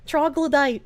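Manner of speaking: ordinary talk, nothing marked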